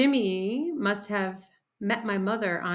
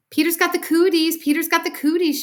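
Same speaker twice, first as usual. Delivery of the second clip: high, loud playground chant; yelled taunt; 10 semitones higher, 8 dB louder than usual. A narrator's reading. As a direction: yell it like a teasing girl. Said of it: "she yelled".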